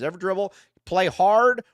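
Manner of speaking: normal talking voice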